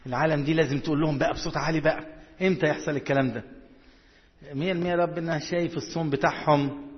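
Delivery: ordinary talk, nothing marked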